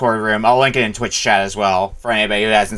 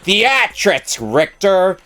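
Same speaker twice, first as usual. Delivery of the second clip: raised pitch, quoting a dramatic line; quoting game dialogue; 8 semitones higher, much slower than usual. Retold or imitated. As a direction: quote it like a stage villain. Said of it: "if you ever heard something like".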